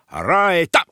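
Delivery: high, loud command; sharp shout to start the jump. A narrator's reading. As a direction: call it out like a gym drill order. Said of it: "Aztán vezényelt".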